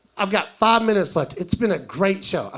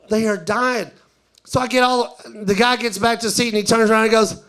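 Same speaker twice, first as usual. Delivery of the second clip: normal talking voice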